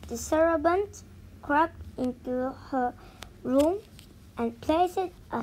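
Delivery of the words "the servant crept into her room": usual speaking voice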